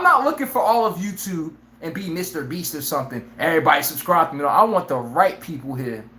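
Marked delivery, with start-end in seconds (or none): none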